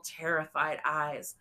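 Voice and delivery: usual speaking voice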